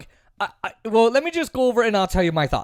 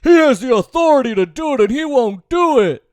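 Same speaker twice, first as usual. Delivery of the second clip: much higher, whiny pleading mockery; mocking whiny activist; 5 semitones higher, 3 dB louder than usual. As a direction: parody their theatrical begging. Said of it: "theater-like thing, like".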